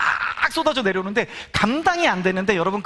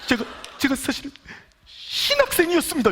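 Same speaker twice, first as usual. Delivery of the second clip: confessing friend; 7 semitones higher than usual. Retold or imitated. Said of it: "말하는 게".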